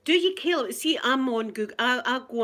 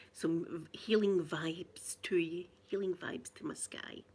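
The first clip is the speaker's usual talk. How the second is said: whispered healing wishes; 3 semitones lower, 7 dB quieter, slower than usual. Real, not acted. Sending healing wishes to viewer.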